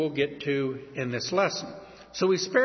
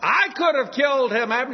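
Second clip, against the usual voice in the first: higher, louder taunting shout across the hills; mock the failed bodyguard from afar; David taunting Abner, a mocking yell; calling out mockingly; 8 semitones higher, 4 dB louder than usual. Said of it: "he calls out to Saul's right-hand man".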